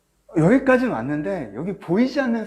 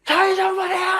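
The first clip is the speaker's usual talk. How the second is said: howling cry; 5 dB louder than usual. Retold or imitated. Lepers howling to Jesus from afar, desperate loud cry. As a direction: cry out like a wounded animal. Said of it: "거의 짐승처럼 울부짖었을 거라고".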